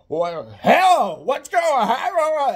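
big stretched-out yell of alarm; brother yelling. Retold or imitated. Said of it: "right away he yells".

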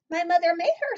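put-on high, loud child character; little Hazel's voice. A narrator's reading. Hazel telling the baker about her doll, high and proud. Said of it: "said Hazel".

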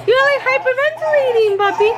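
high, cooing voice for the puppy; high sing-song talk to a pet. Real, not acted. Talking to a panting dog.

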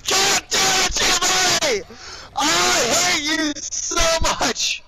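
high, loud, drawn-out scream; furious yelling. Real, not acted. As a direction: yell it right into their ears. Blowing up at Jimmy in game.

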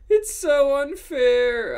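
high, loud, drawn-out whine; whiny yell. A narrator's reading. Ebony protesting how unfair life is, whiny yell. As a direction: yell it like a wronged teenager. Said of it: "I yielded".

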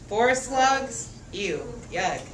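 usual speaking voice

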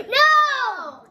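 gasp, then a loud, high wail of refusal; horrified protest. Real, not acted. Child hears it's Vegemite for breakfast tomorrow, horrified protest. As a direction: cry out in dismay.

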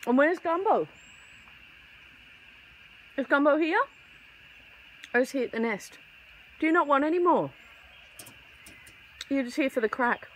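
high, sing-song voice coaxing a bird; pet talk. Acted. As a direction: coax the little bird over, sweet and high. Chatting to birds while feeding.

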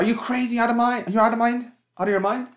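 shocked furious shout. Retold or imitated. Calm woman suddenly screaming at man who stepped on shoes. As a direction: shriek it at him like she's completely flipped. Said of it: "shout like this".

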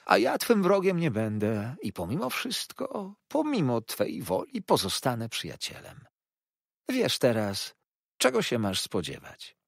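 forgive him with poisonous sweetness; oily humble clerk. A narrator's reading. Narrator voices Uriah.

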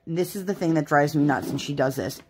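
ordinary talk, nothing marked

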